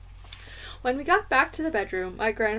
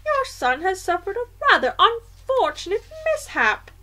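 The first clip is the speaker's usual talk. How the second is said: high-pitched, louder grandmother voice; indignant grandmother; 8 semitones higher, 5 dB louder than usual. A narrator's reading. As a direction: speak up as an affronted old grandmother.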